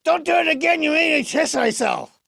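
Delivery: loud, high-pitched puppet outburst; indignant protest. Acted. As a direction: yelp the complaint at high pitch. Puppet protests being impersonated.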